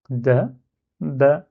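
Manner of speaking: "Da ba" in two separate syllables, pause between; without shadda. Shown as a demonstration. Teacher breaking the word down without shadda, separated syllables. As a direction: say Da, then ba, single light b. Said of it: "si j'enlève shadda, là, ça donne".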